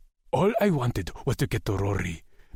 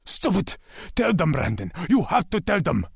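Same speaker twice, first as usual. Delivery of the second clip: desperate demand; 8 semitones higher than usual. A narrator's reading. Lex begging Brandon frantically.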